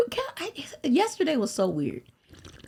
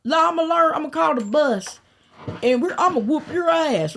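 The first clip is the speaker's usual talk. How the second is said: higher and louder, a brash mock threat; tough threat; 8 semitones higher, 4 dB louder than usual. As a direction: puff up and talk big at the girl. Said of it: "I was like".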